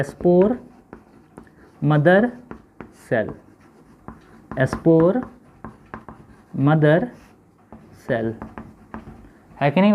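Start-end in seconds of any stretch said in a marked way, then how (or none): 0.0-3.3 spore, mother, cell with long pauses between; one word at a time, leaving writing gaps; word-by-word dictation. Shown as a demonstration. Teacher spelling out SMC for pupils, word by word. 4.6-8.3 spore, mother, cell with long pauses between; one word at a time, leaving writing gaps; word-by-word dictation. Shown as a demonstration. Teacher spelling out SMC for pupils, word by word.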